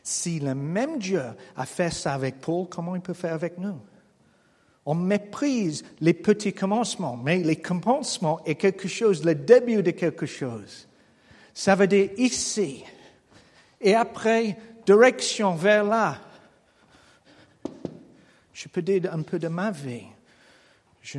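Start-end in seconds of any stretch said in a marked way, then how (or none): 4.9-16.2 louder, rising preacher's push; forceful preaching. Acted. Pastor exhorting the room, forceful preaching. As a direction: drive the point home to the congregation.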